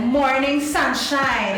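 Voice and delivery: high, slow, drawn-out Morning sunshine; a flirty waiter stretching his greeting; waiter's flirty greeting. Retold or imitated. Waiter greeting a tired guest, slow and high. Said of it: "the waiter comes to me and he's like".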